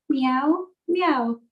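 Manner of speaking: two short imitated meows; cat meow. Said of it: "So the cat went".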